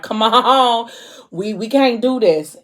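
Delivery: mildly acted pleading protest; retold plea. Man resisting temptation with Mo, mildly pleading.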